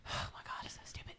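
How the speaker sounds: whispered aside, breath only; a private whispered eye-roll. Real, not acted. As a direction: drop to a bare whisper. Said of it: "unvoiced whispered aside".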